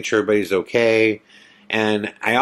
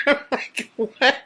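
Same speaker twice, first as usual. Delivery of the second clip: laughing disbelief; 2 semitones higher, slower than usual. Real, not acted. Storyteller reacts to the ghost's sound, helpless incredulous laughter. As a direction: squeak it out while cracking up.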